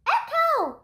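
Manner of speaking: high, loud yelled word; boy yelling for an echo. Acted. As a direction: shout it at the walls. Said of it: "yelled Michael".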